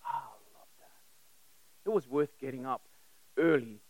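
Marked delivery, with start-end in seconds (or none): none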